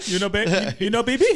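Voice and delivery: words through high laughter; laughing. Real, not acted. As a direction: talk while cracking up. Laughing while talking.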